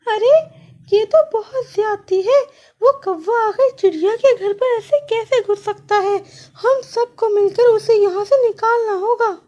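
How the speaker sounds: very high, outraged koel voice; rally the others against the crow; shrill-high little bird, indignant. Acted.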